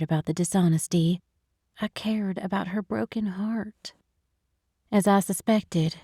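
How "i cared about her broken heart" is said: whispering. A narrator's reading. Narrator's tender aside.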